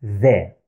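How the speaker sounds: The said low with the tongue between the teeth; a voiced th as in that. Shown as a demonstration. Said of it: "My tongue is coming out".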